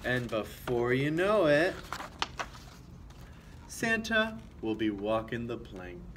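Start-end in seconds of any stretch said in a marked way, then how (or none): none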